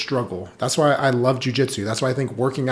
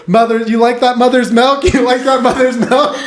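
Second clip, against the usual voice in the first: high, loud playground taunt breaking into a laugh; taunting kid; 11 semitones higher, 8 dB louder than usual. Retold or imitated. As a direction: imitate a cocky kid gloating over a pinned opponent. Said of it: "he'll get on top of kids and go".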